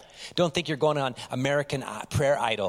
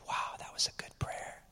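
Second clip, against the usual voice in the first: hushed, whispered admiration; soft whisper of mock awe; awed whisper; 12 dB quieter than usual. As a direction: whisper it like an impressed onlooker. Onlookers admire a showy prayer.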